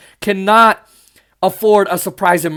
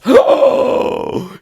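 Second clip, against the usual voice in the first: whispered theatrical gasp of horror; mock shocked gasp. Vlogger mocking believers offended by religion talk, gasp.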